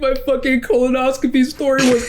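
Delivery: high, cracking with laughter; laughing triumphantly; laughing hard. Real, not acted. Triumphant laughing after win.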